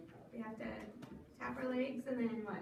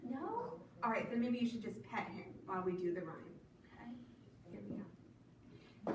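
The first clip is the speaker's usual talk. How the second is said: normal talking voice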